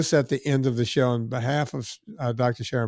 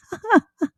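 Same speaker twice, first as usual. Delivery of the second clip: laugh. Real, not acted. Laughs after impression.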